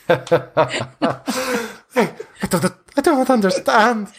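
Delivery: high, pleading and wretched; a starving vampire begs his master for release; starved pleading vampire. Acted. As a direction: beg like a starving creature locked away. Confused spawn.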